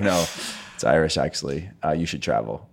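low, soft deadpan correction; mock put-down. Imagined reply to clueless Americans, low mock put-down. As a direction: drop low and quiet to correct them. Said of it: "And you could be like".

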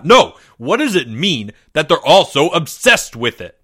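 loud exasperated outburst; angry despite himself. A narrator's reading. Mister L puzzling over the boys' obsession, angry despite himself. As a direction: break into frustrated emphasis. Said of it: "angry despite himself".

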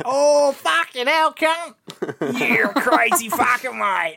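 high, slow hammed-up Australian; Australian accent cranked up for laughs. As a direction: bellow it like a rowdy Aussie bloke. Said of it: "An Australian accent".

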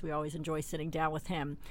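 ordinary talk

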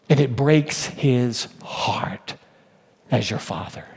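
soft, slow whisper of grief. Acted. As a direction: breathe it, almost no voice. Sermon climax, voice drops.

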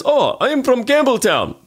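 high, slow mock Russian voice; a playful take of the Russian, slow; imitating Russian man. Russian man's punchline about Campbelltown, mock voice. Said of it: "And he goes".

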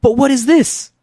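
high-pitched, at a loss for words; aghast, voice pushed high; exasperated. Acted. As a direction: sputter helplessly in acted dismay. Acted despair at the soup.

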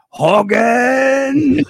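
put-on high shouted Hogan, German accent; Colonel Klink. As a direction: bellow the name as a foiled villain. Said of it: "Klink would be like".

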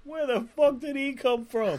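high-pitched imitation of an actor's line; Dick Ritchie audition reading. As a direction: put on a high, mock audition voice.

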